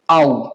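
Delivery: आउ as one low gliding "ow"; low, distinct आउ for German au; joined AU sound. Shown as a demonstration. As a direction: glide A into U: आउ. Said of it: "एक साथ क्या बोलते हैं".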